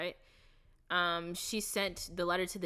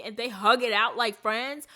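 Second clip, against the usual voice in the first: higher and much louder, thrilled; excited gushing; 6 semitones higher, 12 dB louder than usual. Real, not acted. Gushing to viewers about the friends' hug, excited. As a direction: gush about it with delight.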